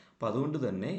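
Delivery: usual speaking voice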